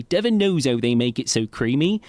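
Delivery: imitating a West Country custard ad voice; mock Devon accent from a TV ad. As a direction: say it like a Devon local in an old ad. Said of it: "and they all talk like this".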